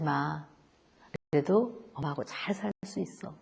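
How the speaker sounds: soft, low, tender reassurance; gentle comforting parent. Retold or imitated. Parent comforting a grieving child, soft and low. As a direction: hold the child and soothe him softly.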